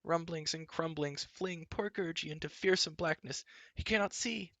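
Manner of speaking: fearful, fretful Gurgi; frightened creature voice. A narrator's reading.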